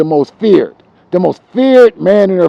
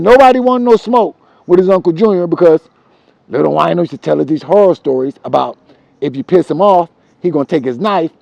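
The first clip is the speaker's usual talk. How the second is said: ordinary delivery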